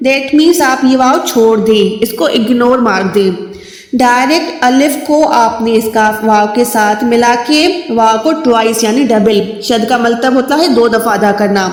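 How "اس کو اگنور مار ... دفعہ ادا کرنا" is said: normal talking voice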